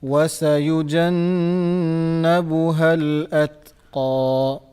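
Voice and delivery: slow, melodic teaching recitation; tajweed model recitation. Shown as a demonstration. Teacher models the ayah for the student, slow chant. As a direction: stretch each sound so the student can copy. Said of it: "teacher models for student".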